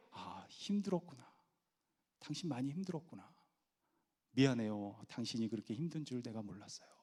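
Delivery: very soft, tender acknowledgement; empathetic wife. Shown as a demonstration. Wife consoling tired husband, soft empathetic apology. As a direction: apologise gently in a hushed voice. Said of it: "그 감정을 얘기해주면".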